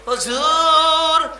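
high, long-drawn call. Acted. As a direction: draw it out high, calling to a revered master. Calling out to the Prophet. (উঁচু গলায় লম্বা টানে ডাক)

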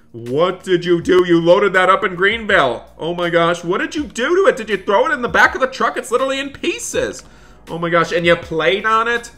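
loud, high-pitched telling-off in disbelief; aghast parent demanding answers; appalled scolding. Acted. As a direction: climb into a frantic, shouted scolding. Dad berates son over smashed computer.

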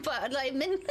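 ordinary talk

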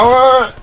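loud high whoop of surprise; startled loud yelp; exclamation. Real, not acted. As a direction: let out a sudden whoop. Exclaiming at the outcome.

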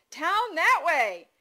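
put-on high, slow, loud toddler command; toddler yell. A narrator's reading. Toddler ordering mother toward town, high drawn-out yell. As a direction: holler each word like a two-year-old. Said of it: "Dawson yelled".

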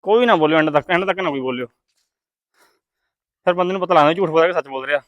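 low, soft voice asking for silence; hushed request. Real, not acted. Host to spectators during the blindfold turn, hushed request. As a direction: keep it down so the player cannot follow.

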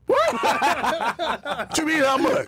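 high laughing protest at being asked to pay; mock outraged disbelief. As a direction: act shocked and offended, half laughing. Acted outrage at being asked money.

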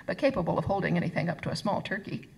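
ordinary delivery, nothing marked

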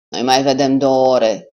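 put-on loud, slow, playful old-man exclamation; joking exclamation. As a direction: call it out as a teasing joke. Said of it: "exclamă bătrânul glumeț".

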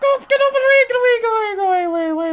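high, loud panicked yelling; panicked high shout. Real, not acted. Gamer screaming at the monster to get away. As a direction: shriek to drive the monster away.

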